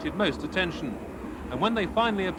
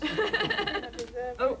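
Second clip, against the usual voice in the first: short real laugh; 8 semitones higher, 4 dB quieter than usual. Real, not acted. Laugh after closing report.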